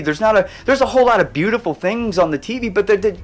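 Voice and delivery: loud, fervent appeal to the audience; impassioned preaching. Real, not acted. Urging viewers to go get their happy, preached loud. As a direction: preach it from the heart, full force.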